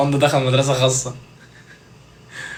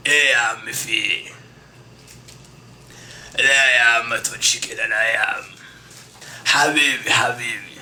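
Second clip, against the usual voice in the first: scrap dealer call; 4 semitones lower, 4 dB quieter than usual. Retold or imitated. Imitating scrap buyer call.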